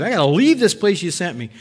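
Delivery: normal talking voice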